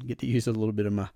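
ordinary talk

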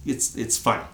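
normal talking voice

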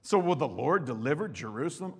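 loud, high defiant reading of the taunt; Assyrian king's taunt read aloud. Acted. Preacher reading the Assyrian king's taunt, booming. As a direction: hurl the challenge.

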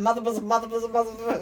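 laughing, rapid repeats; fast tongue twister. Shown as a demonstration. Tongue twister dare between sisters, said fast. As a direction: rattle it off fast. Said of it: "three times fast".

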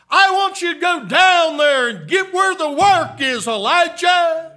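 high, loud preaching build; preaching shout. Acted. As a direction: call it out over the congregation.

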